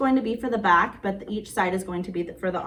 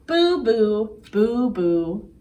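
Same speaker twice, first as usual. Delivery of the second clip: louder spooky mock boos; ghost boos; 4 dB louder, much slower than usual. Ghost saying good night to papa, louder playful boos. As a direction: boo it out like a friendly ghost.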